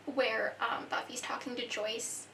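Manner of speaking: usual speaking voice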